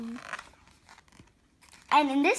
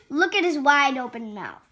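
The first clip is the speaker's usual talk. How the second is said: usual speaking voice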